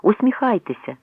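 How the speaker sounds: mild, high photographer's call, repeated; shouted calls. A narrator's reading. Reporters calling to the travellers for a photo, shouting. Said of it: "Вигуки".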